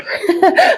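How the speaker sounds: a high, bright burst of laughter; a real, squeaky giggle. Real, not acted. Laughing at own healer claim.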